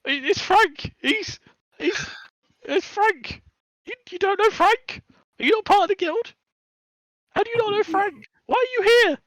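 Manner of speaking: squeaky high panicked kobold voice; a terrified little thief babbling apologies; blubbering kobold. Acted. Kobold caught stealing carrots, panicked high squeak.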